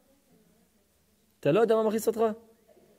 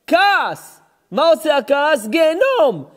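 higher, louder preacher's cry; fiery preaching; 8 semitones higher, 6 dB louder than usual. Acted. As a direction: thunder it from the pulpit. Preacher rises to full force. (הטפה נלהבת בקול רם)

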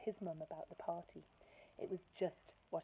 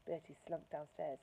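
plain reading voice

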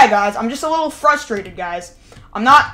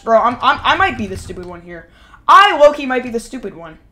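ordinary talk, nothing marked

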